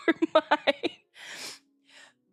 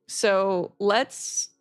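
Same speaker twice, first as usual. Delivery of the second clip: ordinary talk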